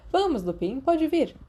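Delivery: gently coaching the class; friendly teacher. A narrator's reading. Ferox encouraging Remus. (professor simpático, voz levemente mais aguda)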